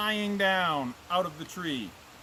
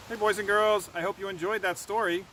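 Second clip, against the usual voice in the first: ordinary delivery, nothing marked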